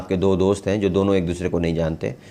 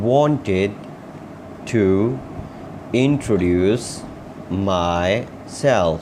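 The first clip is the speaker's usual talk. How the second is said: very slow, a pause after every word; word-by-word model; much slower than usual. Shown as a demonstration. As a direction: dictate it to learners one word at a time. Teacher models sentence word by word.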